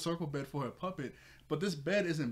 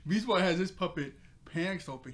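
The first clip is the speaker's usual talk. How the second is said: higher, louder, laughing as he says it; 5 semitones higher, 7 dB louder than usual. Real, not acted. The reviewer describing Beast Boy's puppet gag, laughing. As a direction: crack up at the gag.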